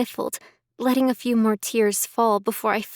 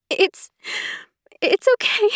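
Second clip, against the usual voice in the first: tearful; 10 semitones higher than usual. Acted. Emily composing herself, crying.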